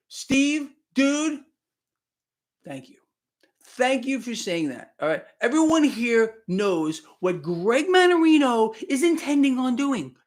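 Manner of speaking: usual speaking voice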